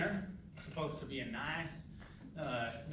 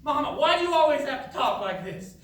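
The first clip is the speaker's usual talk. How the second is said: loud, high, exasperated shout; angry outburst; 11 semitones higher, 12 dB louder than usual. Acted. Son at dinner yells at his mother to stop, angry. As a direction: snap at her to stop.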